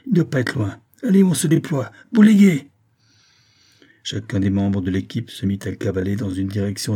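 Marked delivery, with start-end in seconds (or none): 0.1-2.7 building to a loud high shout; rally the squad, louder on each line; shouted orders. Acted. Pérez ordering his team to fan out, building to a shout.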